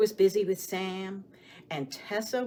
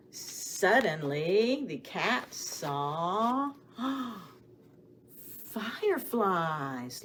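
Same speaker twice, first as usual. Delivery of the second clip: drawn out very slowly, building suspense; slow down and hold them in suspense; much slower than usual. Acted.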